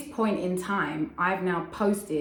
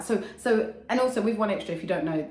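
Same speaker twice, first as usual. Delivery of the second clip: usual speaking voice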